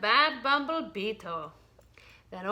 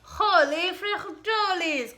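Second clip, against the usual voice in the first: slow, high, loud exclamation; startled cry; 7 semitones higher, 5 dB louder, slower than usual. Acted. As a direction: cry out in shock. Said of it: "cried Skipito".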